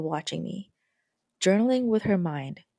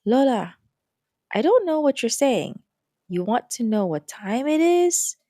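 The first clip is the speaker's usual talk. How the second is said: confused; 6 semitones higher, 4 dB louder than usual. Acted. Confused granddaughter answering Lola.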